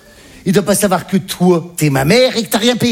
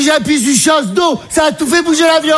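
high-pitched mum in a panic; imitated mother; 9 semitones higher than usual. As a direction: explain frantically in the high mother voice. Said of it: "Elle dit". (maman imitée affolée, voix très aiguë)

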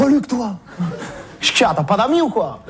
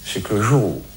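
much lower, softer, earnest voice; calmed-down sincere; 8 semitones lower, 3 dB quieter than usual. Acted. Reformed street guy shares his wise message, calm and low. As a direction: settle in and preach calmly. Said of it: "je me suis calmé".

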